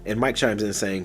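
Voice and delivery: ordinary delivery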